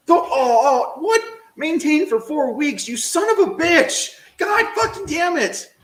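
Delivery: an outraged gamer's scream; furious shout. Real, not acted. Streamer raging at the game's four-week demand, furious yell.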